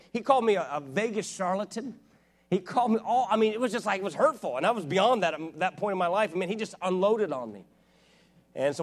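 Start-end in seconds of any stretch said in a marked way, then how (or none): 0.1-6.3 higher, louder, driving sermon climax; full-force preaching. Acted. Telling the church about the pastor's attack, impassioned preaching. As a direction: preach it hard to the crowd.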